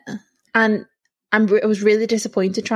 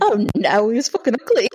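laughing; 5 semitones higher, faster than usual. Real, not acted. Laughing about Henry.

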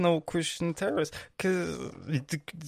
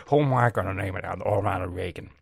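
lower, lightly voiced Nixon; President Nixon; 6 semitones lower, 4 dB louder than usual. Comic voices Nixon choosing whom to bomb, lower and brisk. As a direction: muse gravely like Nixon picking a name. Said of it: "sound like President Nixon".

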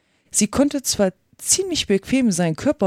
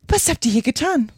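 higher-pitched imperious questioning; stern queen; 5 semitones higher than usual. A narrator's reading. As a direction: question them as a haughty queen. Königin verhört die Gärtner. (strenge Königin, höhere Stimme)

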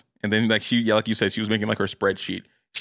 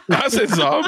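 laughing; 11 semitones higher, 5 dB louder than usual. Real, not acted. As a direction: repeat it through real laughter, higher and louder. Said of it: "Did you just call him Zom?".

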